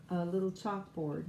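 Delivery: usual speaking voice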